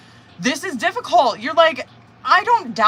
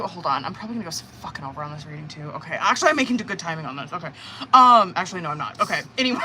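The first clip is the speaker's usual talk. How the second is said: ordinary talk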